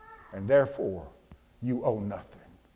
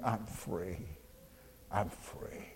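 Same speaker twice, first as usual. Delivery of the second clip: much softer, almost whispered, fading away; hushed echo; 6 semitones lower, 18 dB quieter, slower than usual. Acted. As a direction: let her song drift off into a whisper. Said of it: "She's never stopped singing".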